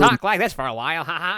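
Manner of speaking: lightly put-on voice-over voice; voice-acting voice. Shown as a demonstration. Tattooist showing off amateur voice acting, lightly put-on voice. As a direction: put on a character voice for the mic. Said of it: "I wanted to do vo-, voice acting".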